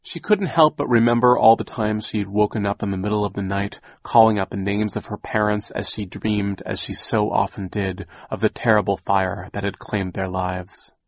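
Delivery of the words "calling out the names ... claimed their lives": plain reading voice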